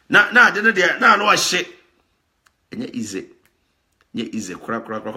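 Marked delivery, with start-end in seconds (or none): 2.7-5.1 quiet. Real, not acted. Quiet reflective repetition.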